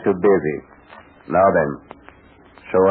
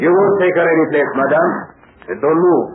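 commanding shout; 10 semitones higher, 6 dB louder than usual. Acted. Bursting in on the villain.

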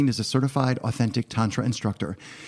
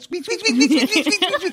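very high squeaky voice; rubbery high squeaky toy; 4 dB louder than usual. Retold or imitated. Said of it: "he went".

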